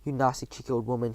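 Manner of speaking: ordinary delivery, nothing marked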